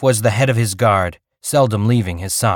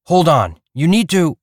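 loud, higher call to someone leaving; calling after someone; 8 semitones higher, 4 dB louder than usual. Acted. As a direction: shout after him to wait. Reef calls after departing Perry.

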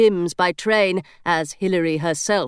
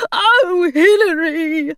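higher, slow pleading cry of the gargoyle; a gargoyle begging Hilary; anguished gargoyle; 9 semitones higher, 5 dB louder, much slower than usual. A narrator's reading. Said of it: "looked so earnest".

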